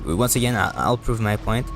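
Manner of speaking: ordinary delivery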